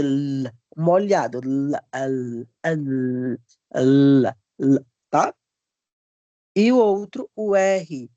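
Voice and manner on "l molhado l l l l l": l sustained low, tongue on the palate; held liquid L. Shown as a demonstration. Teacher isolating the letter L, long low held l. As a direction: draw out the l like a low sung note. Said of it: "é o som assim de uma cantoria mesmo".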